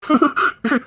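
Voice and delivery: high bright burst of laughter; amused laugh. Acted. Man laughing off his lover's fear, amused laugh.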